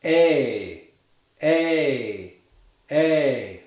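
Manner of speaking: A as one fluid glide into ee; drawn-out diphthong A. Shown as a demonstration. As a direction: slide smoothly from eh to ee in "A". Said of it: "one fluid motion between the two sounds".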